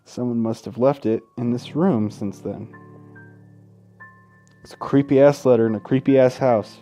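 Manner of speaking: usual speaking voice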